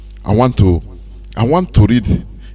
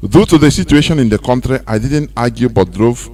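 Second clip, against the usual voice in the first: low, steady read-out of a written testimony; reading a post aloud; 4 semitones lower than usual. Acted. Pastor reads a victim's post to listeners, steady read-out. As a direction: read it out like a quoted letter.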